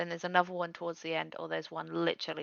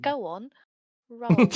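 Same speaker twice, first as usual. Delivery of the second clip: loud, raised-pitch goading; mock egging-on; 5 semitones higher, 10 dB louder, slower than usual. Player mimicking friend pushing for a roll, mock egging-on. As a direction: egg them on, playfully overdone.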